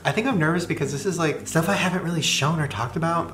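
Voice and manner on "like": ordinary talk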